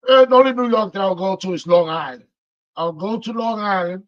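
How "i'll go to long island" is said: ordinary talk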